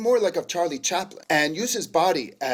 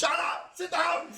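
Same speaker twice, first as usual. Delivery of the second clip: high joking yell; mock shout; 8 semitones higher than usual. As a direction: shout them down playfully. Shouting down the imagined laughter.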